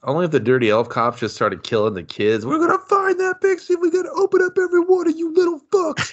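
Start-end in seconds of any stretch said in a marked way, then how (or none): 2.4-6.1 very high, cartoonish threatening cop; crazed cop. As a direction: menace the kids, unhinged. Elf cops threatening kids.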